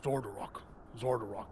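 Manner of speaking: low, soft, fading mutter. Acted. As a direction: mutter the name to yourself, low. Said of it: "he's like".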